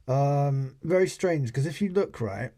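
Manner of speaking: normal talking voice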